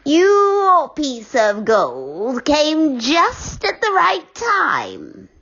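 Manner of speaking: very slow, strange witch voice, rising. Acted. Witch thanking Moonface for the gold, slow and eerie. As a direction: savour each word like a pleased old witch.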